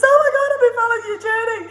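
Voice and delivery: high, fast, loud mock-tearful gush; emotional fan. Overwhelmed fan confessing to the traveller, high imitated tearful gush. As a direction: gush it squeakily through laughter. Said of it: "I'm not normally this emotional".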